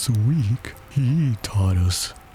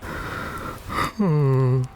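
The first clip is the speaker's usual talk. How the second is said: long mournful sigh; deep sigh; 6 semitones higher than usual. A narrator's reading. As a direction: heave a deep sad sigh. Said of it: "with a deep sigh".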